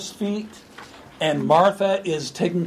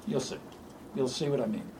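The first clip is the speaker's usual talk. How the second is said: softer, lower reassurance; gentle quiet promise; 5 semitones lower, 12 dB quieter, faster than usual. Acted. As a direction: promise it softly. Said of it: "he says to her".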